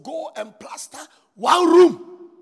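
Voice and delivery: loud shouted order, slow; shouting at his brother to plaster one room; shouted command. Retold or imitated. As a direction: bellow the order at him. Retold command to junior brother.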